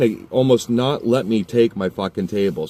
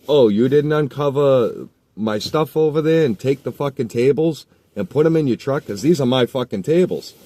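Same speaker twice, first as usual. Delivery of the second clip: raised, heated accusing voice; angry confrontation; 6 semitones higher, 4 dB louder than usual. Retold or imitated. To the kid who stole his tables, heated and raised. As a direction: confront the thief, loud and higher. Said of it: "I'm like".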